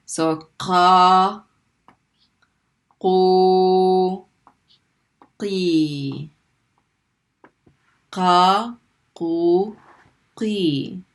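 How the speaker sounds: loud clear drill of each syllable; Arabic q drill; heavy qaf syllables. Shown as a demonstration. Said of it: "drill of heavy qaf".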